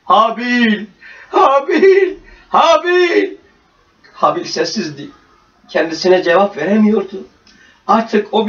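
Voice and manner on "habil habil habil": desperate calling. A narrator's reading. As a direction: shake him and call his name ever more desperately. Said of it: "şiddetle sarsarak bağırdı".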